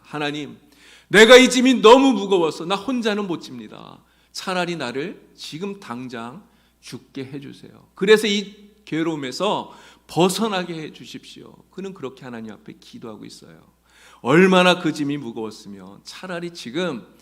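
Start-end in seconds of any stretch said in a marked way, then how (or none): 0.0-11.5 loud, higher desperate begging; desperate plea. Retold or imitated. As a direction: plead to be released from the burden. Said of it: "그래서 그는 말합니다". (절박하게 크게 간청하는 기도)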